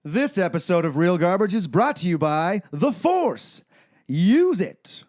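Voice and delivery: hammed-up movie-trailer style plug; mock sponsor read. Host opening the episode with a joke ad, booming announcer read.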